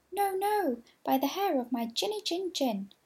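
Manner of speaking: high little pig voice, pleading; high squeaky pig. A narrator's reading. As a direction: squeal it out like a frightened piglet. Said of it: "said the wolf".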